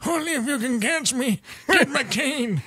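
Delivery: put-on high, loud creaky old geezer; old man voice. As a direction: taunt like a frail grandpa. Joins old-man bit.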